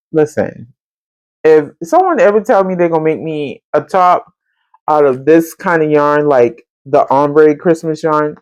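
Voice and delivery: ordinary talk, nothing marked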